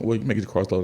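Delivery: usual speaking voice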